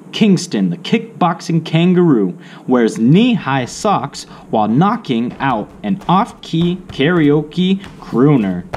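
plain reading voice

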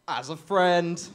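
loud, sudden blurted add-on; awkward blurt. Retold or imitated. Grandson answering grandma's love, loud awkward blurt. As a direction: blurt it out without thinking.

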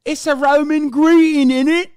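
put-on high, loud British lad accent; mock British accent. Streamer mocking the Roman salute excuse in a British accent. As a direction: sneer it like a cheeky British lad. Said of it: "Why are you so good with that accent?".

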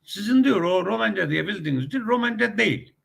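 high-pitched imitation of insistence; ısrarcı Rus ağzından. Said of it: "Ruslar diyor ki". (tiz perdeden, dayatan Rus taklidi)

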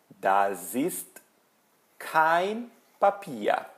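deliberate example highlighting "kein"; model negation. Shown as a demonstration. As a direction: lift and stretch "kein" after a pause. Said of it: "é o kein".

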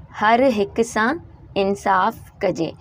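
हर हिक सां, pause, इंसाफ़ कजे, each half slowly; slow dictation in two parts; split slow reading. Shown as a demonstration. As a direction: break the line in two for the class. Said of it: "ये दो लाइनें हैं".

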